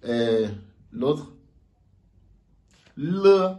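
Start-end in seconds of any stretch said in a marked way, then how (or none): 3.0-3.5 le high and loud, as Mandarin le; le said like the Chinese l; le as the Chinese letter l; Chinese l sound. Shown as a demonstration. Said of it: "Bonne réponse".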